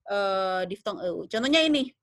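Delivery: ordinary delivery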